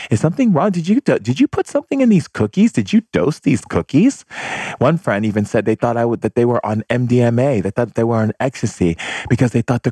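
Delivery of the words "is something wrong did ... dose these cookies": high, rising alarmed questions; alarmed disbelief. Retold or imitated. Guests suspecting the shaman drugged cookies, alarmed. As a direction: act out a guest suddenly suspicious. Friends retold freaking out over cookies.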